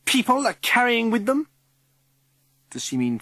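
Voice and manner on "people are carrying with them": very high, loud alarm; crying out. A narrator's reading. Governor warning the head about visitors, crying out. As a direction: cry out a scandalised warning. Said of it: "cries Missus Summers".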